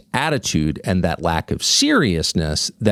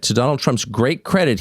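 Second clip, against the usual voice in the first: ordinary talk, nothing marked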